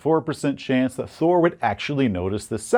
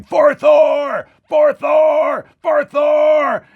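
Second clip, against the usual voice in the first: high, long war cry carried across water; distant shouting; 13 semitones higher, 7 dB louder, much slower than usual. Acted. Shouting heard across the pond from the village. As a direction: shout it loud, stretching each cry. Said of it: "you can hear this distant shouting".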